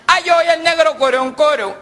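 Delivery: high put-on voice; squeaky driver voice. Driver giving his name to police, high squeaky voice. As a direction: keep the squeaky panicky voice.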